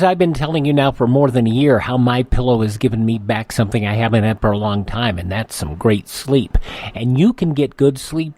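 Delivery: normal talking voice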